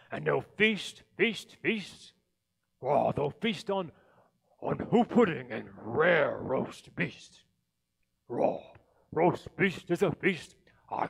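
loud, disgusted Grinch; disgusted rant. Acted. The Grinch ranting about the Who feast, loud disgust. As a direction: hammer each feast with contempt.

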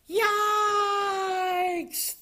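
long, very high, loud wail; alarmed scream. A narrator's reading. Froggy flies with the ball, long high scream. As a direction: scream it out, stretching the vowel.

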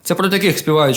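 plain reading voice

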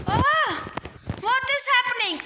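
high, exasperated outcry; going mad. Real, not acted. Gamer stuck out of the game, going mad. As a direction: burst out in high-pitched frustration. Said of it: "I am going mad".

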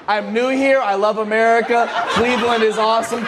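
ordinary delivery

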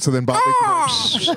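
high stretched eagle screech; hawk screech. Pretend. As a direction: screech like a bird of prey. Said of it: "I wish I could do an eagle sound".

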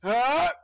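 loud, high yell; sudden rising battle cry; short "Ah!" yelled high and loud; attack yell. Real, not acted. Attacking something in the game.

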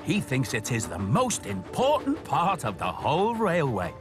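ordinary delivery

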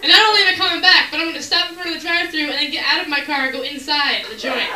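loud, fast, heated rant; rising rant. Acted. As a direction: ramp up the outrage. Angry rant build-up.

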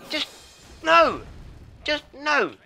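high-pitched protest; high, falling cry of refusal; exasperated cry. Real, not acted. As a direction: wail the no in frustration. Refusing in frustration.

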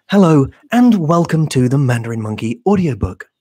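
audiobook narrator voice. Shown as a demonstration. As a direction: read it like a studio audiobook intro. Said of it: "because I speak like this".

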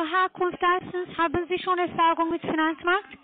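normal talking voice

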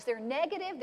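ordinary talk

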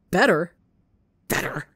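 a loud bitter repeat of Better?; incredulous outburst. A narrator's reading. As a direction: spit the word Better? back loud and bitter.

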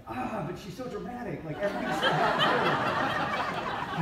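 high, slow laughing whine about a dramatic mom; laughing excuse. Retold or imitated. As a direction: squeal it through laughter.

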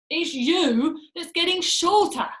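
slow, loud, relishing taunt; gloating grin. A narrator's reading. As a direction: draw it out with a horrible grin. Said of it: "grinning horribly".

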